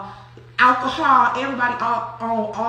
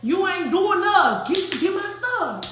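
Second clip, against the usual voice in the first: slow, higher mimicked Saint Louis accent; 6 semitones higher than usual. Showing how Noonie demanded her stuff, Saint Louis accent. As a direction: draw out the Saint Louis R, pitched up. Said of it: "get the R in".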